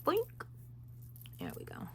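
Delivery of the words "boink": high boink. Real, not acted. Ring pops free, sound word.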